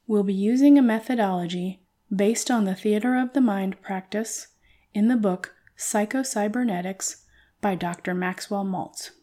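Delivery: ordinary talk, nothing marked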